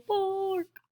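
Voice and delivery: high, long bark; watchdog alarm. Acted. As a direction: hold the word high like a siren.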